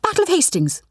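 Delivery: quick proud answer; eager declaration. A narrator's reading. Rani blurts out the history answer, lightly eager. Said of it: "declared Rani".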